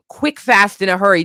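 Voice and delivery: ordinary talk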